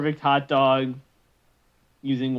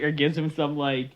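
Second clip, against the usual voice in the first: normal talking voice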